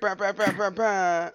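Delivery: high, loud nonsense bahs; goofy chant of bahs; playful babbling bahs; silly bah bah. Pretend. Goofy noise banter.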